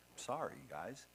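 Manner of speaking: normal talking voice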